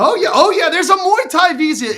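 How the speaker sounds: loud, high-pitched burst of excitement; real excited outburst, pitch way up; excited insisting. Real, not acted. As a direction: shout it eagerly over the laughter. Excited insisting over host's laughing doubt.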